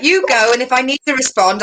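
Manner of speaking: usual speaking voice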